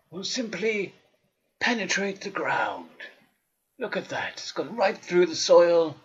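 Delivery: hushed wildlife narrator voice; nature documentary. Acted.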